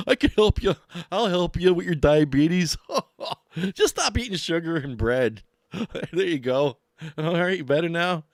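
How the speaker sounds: loud, high, dopey mock helper; hammed-up buffoon breaking into goofy laughs; mocking know-it-all. Vlogger mimics people offering useless diabetes help, mocking.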